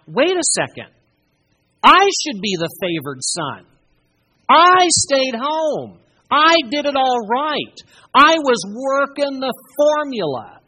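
loud, high, aggrieved protest; indignant complaint. Acted. Older son protesting loudly to his father, aggrieved. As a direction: whine it out like the wronged son.

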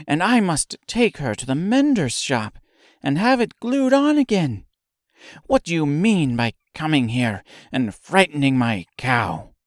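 high, loud scolding milkmaid; angry scolding. A narrator's reading. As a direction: scold them furiously. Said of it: "cried the milkmaid angrily".

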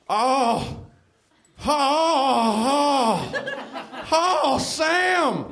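high, drawn-out hammed-up moans; mock moaning. A narrator's reading. Narrator plays Jim Baker calling to Sam, hammed-up moaning. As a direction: moan it theatrically in a high voice. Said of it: "moaned Jim Baker".

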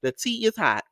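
high, loud sassy diva calling out; campy drag queen. Acted. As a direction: spill the tea like a drag diva. Campy gossip intro before story.

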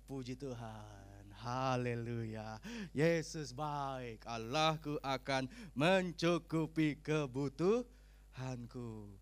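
very slow, low, drawn-out praise; devout praise. Acted. Preacher models a believer praising God despite losing money, slow and devout. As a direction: stretch each word into a solemn, trusting declaration. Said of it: "ngomongnya gini".

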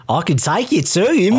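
put-on Australian little girl. Podcaster mimics the film's little girl, hammed-up Australian accent. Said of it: "little tiny girl with an Australian accent".

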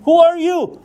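high-pitched, startled challenge; startled question. Retold or imitated. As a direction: ask it as Saul taken aback, pitch leaping up. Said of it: "Persecuting you?".

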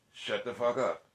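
ordinary talk

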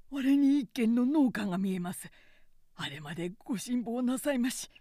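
loud, urgent nephew calling ahead; urgent encouragement. A narrator's reading. Nephew urges exhausted lord onward.

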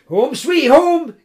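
high, loud, slow happy cry; joyful cry. Acted. Koma crying out at the farmyard, high and slow. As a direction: savour the relief out loud. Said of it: "cried Koma".

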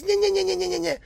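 high mocking whine nyeh nyeh; whining nyeh. Mocking whiny people to viewers, high nyeh nyeh. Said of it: "stop being a whining motherfucker".

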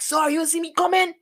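high, pushy Guyanese confrontation; confronting the driver. Retold or imitated. Young driver confronting the man who hit him, high and pushy. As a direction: challenge him in a put-on Creole voice. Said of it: "I was like".

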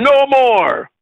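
high, drawn-out cry of refusal; sick and tired. Real, not acted. Preacher refusing the condition the church is in, sick and tired. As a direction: burst out fed up, pitch leaping up. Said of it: "sick and I'm tired of this condition".

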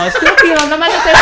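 high, loud laughing protest; mock outraged shout. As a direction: yell it half laughing.

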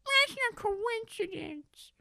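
very high, slow squeaky cat voice. Acted. Host's cat character introducing itself, very high and slow. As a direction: announce yourself proudly as a tiny cat. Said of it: "I'm Adolf Hitler, the cat".

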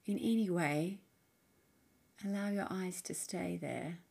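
soft, unhurried meditation voice with long pauses; guided meditation. Acted. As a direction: gently guide the listener inward, leaving space between phrases. Leads a guided mindfulness practice.

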